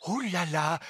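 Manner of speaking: usual speaking voice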